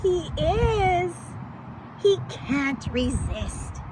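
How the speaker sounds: usual speaking voice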